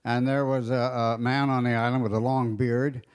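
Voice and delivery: ordinary talk, nothing marked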